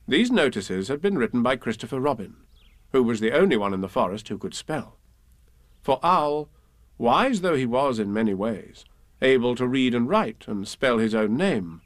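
plain reading voice

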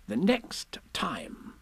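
slow, high, measured instruction; Rabbit's secretive aside. Acted. Rabbit passes a message to Piglet, slow high aside.

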